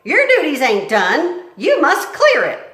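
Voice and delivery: loud, high scolding; bossy cook. Acted. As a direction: order her about loudly. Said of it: "said Cook".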